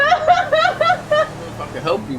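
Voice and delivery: high, slow laughing refusal. Acted. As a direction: giggle through the words. Laughing while refusing help.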